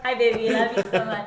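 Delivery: loving greeting. Real, not acted. Wife greets her husband Gary as he walks in, loving. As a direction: call out fondly to her husband.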